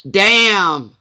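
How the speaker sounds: loud, high, drawn-out outburst; real exclamation. Real, not acted. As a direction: burst out in loud disbelief. Exclaims after the mix-up.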